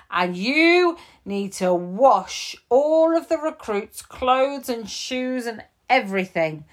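high, loud exasperated scolding; furious and barely able to get words out; cross chief. Acted.

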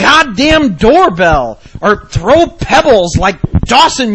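loud, high, slow scolding; mom's hammed-up tirade; angry mom. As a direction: hammer each word like a fed-up mother.

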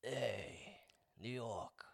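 low, soft New York accent. Shown as a demonstration. Friend challenges host to a non-Italian accent, New York accent.

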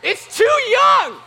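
very high, drawn-out full-force yell; hammed-up fatherly outrage at top volume; outraged shout. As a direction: scream it like an appalled dad, stretching every word. Outraged father reacting in his story.

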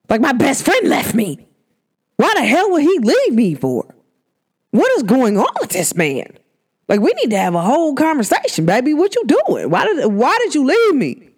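acted-out heartbroken rant; retold hurt protest. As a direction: demand answers like someone abandoned. Acts out grief rant at friend.

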